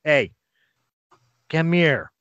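mild yell to someone nearby; retold call. Player voices Dabaj calling Argos over, mild yell. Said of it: "he yells to Argos".

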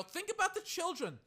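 high-pitched mocking whine of a quote; mocking nerd. Streamer imitating a reporter on taunting, mocking nerd. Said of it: "the little nerd that he is".

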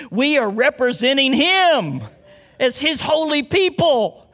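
emphatic preacher's climax; forceful preaching. Real, not acted. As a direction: declare it with loud conviction. Preacher proclaims the sermon's point.

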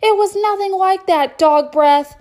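high, loud angry yell; furious shout. Acted. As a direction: yell at your brother. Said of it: "Elliot threw down his fork".

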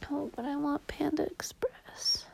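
tired hushed whisper. Real, not acted. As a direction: whisper it sleepily. Tired, whispering late at night.

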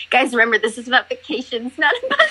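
talking through real laughter; laughing. Real, not acted. Reminding friends it's about vacations, laughing.

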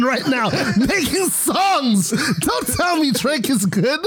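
high-pitched, incredulous ranting; worked-up rant. Real, not acted. Co-host arguing [name] uses ghostwriters, worked-up high rant. Mock outrage about ghostwriters.

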